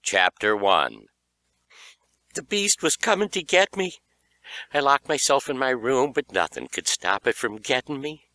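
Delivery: usual speaking voice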